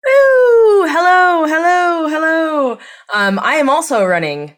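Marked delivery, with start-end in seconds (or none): 0.0-2.8 high, loud whoop easing into drawn-out hellos; excited whooping greeting. Real, not acted. Guest invited back to the podcast, whooping excited hellos. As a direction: sing out a big high hello. Guest makes a big entrance.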